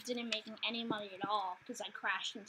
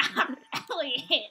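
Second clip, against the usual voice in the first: snorting laugh, then a loud crap; laughing snort through the nose; amused nose exhale; 3 semitones higher, 12 dB louder, slower than usual. Real, not acted. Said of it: "Did you just snort?".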